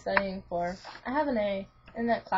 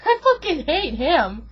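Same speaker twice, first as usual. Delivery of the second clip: slow, louder, higher mock outburst; mock loathing; 5 semitones higher, 5 dB louder than usual. [name] pushes Adam out of the boat, mock angry. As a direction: act out disgusted anger, hammed-up.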